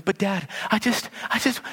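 put-on high, stammering child's protest; a kid trying to explain; pleading kid. Child stammering a plea to his dismissive dad, high and pleading.

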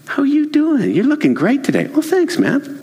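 high-pitched, upbeat pleasantries; acted friendly greeting, pitch lifted; friendly check-in. Shown as a demonstration. Coworker complimenting someone before asking for a file, friendly.

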